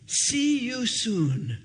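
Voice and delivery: slow, each word set down deliberately; carefully memorized phrase. Retold or imitated. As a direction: say it slowly like newly learned English. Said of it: "She had memorized that".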